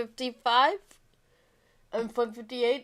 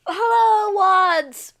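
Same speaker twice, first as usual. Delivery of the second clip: loud, high cheery hello; excited greeting; 12 semitones higher, 10 dB louder, much slower than usual. Real, not acted. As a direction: greet the chat with a big high shout. Stream greeting.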